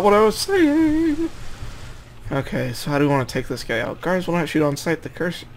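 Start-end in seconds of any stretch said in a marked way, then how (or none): 0.0-1.3 silly voice. Acted. Jokes after losing his line.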